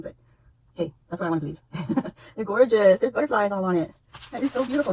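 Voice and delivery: ordinary talk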